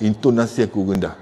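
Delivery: dropped pitch, gently said; low intonation. Shown as a demonstration. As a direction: keep it down low.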